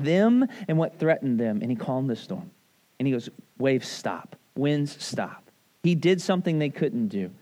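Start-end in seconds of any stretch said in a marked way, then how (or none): 3.6-5.4 low, softened order; calm command. Retold or imitated. Jesus tells the waves and winds to stop, calm low command. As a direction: command it quietly, without any push.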